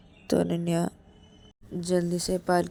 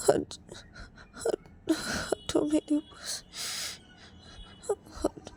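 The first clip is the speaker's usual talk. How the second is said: crying; 12 semitones higher, slower than usual. Acted. Payal, crying, tells Ananya to get away from her. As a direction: sob out get away, rising to a cry. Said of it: "रोते रोते बोलती है".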